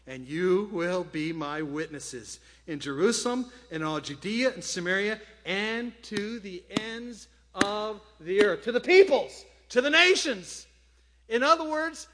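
loud preaching building to a high shout. Acted. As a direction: proclaim the verse, rising to full force. Preaches scripture, building to full force.

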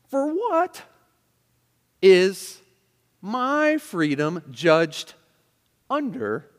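high "what", then slow, heavily stressed words; indignant attitude. Acted. Preacher rereading the verse with "under", slow indignant emphasis. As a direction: challenge it word by word, stretching "my". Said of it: "you hear the attitude".

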